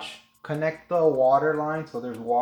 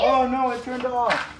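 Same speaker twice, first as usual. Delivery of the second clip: dismayed; 10 semitones higher than usual. Real, not acted. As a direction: react as the engine dies. Engine stalls suddenly.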